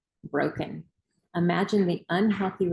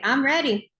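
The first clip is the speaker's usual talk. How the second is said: louder, higher I'm ready; proclaimed loudly; 7 semitones higher, 6 dB louder than usual. Real, not acted. Host leading Cheryl in the chant, proclaiming loudly. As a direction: announce it with pride. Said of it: "Okay, so let's say it".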